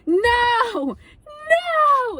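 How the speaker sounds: high, loud crying no; hammed-up breakdown over the cancelled ride; despairing wail. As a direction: wail it like your world is ending. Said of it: "And I'm just like".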